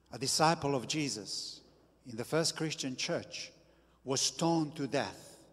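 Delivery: normal talking voice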